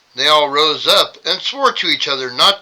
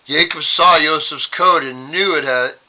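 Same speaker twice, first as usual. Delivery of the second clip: ordinary delivery, nothing marked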